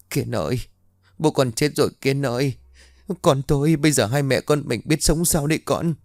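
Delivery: a mother wailing to her son that his father died; whimpering lament. Acted. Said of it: "mếu máo".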